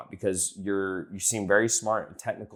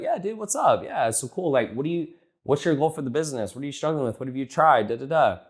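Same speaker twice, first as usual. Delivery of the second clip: higher-pitched, breezy phone chumminess; rapport-building chat; 5 semitones higher than usual. Acted. Coach calls a prospect to get liked, breezy rapport chat. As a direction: rattle off friendly questions in a raised voice. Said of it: "I'm just building rapport".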